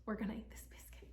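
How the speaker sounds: quick excited whisper. Real, not acted. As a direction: whisper before the bite. About to eat the biscuit.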